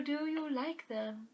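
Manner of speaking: normal talking voice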